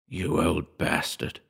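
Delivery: slow, hushed accusation; low-volume, drawn-out curse under the breath; whispered. A narrator's reading. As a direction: whisper it slowly into the urn. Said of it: "I whispered".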